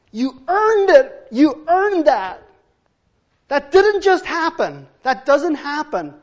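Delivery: high, loud, slow preaching; preaching emphasis. Real, not acted. Preacher driving home that the mockery was earned, loud and slow. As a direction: hammer it home to the congregation.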